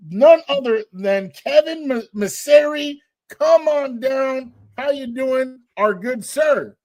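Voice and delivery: slow, drawn-out host welcome; game show host. Acted. Game show guest call.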